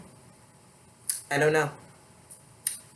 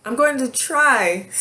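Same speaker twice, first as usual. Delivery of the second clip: real emotion breaking through, voice jumping higher; fighting back tears as you apologize; tearful, apologetic, voice catching; choking up; 7 semitones higher, 3 dB louder, slower than usual. Real, not acted. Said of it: "I'm getting emotional".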